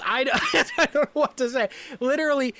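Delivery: can't get through it for laughing. Real, not acted. Laughing at the absurd scene.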